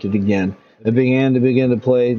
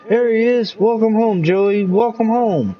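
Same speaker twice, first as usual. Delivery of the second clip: higher excited calls; higher festive calling; 9 semitones higher, slower than usual. Acted. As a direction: shout the welcome across the platform. Crowd and boy calling out.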